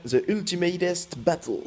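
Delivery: game title in a showy, drawn-out English accent; mock English accent. Shown as a demonstration. YouTuber announcing the game's name, in a showy English accent. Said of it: "Avec mon superbe accent anglais".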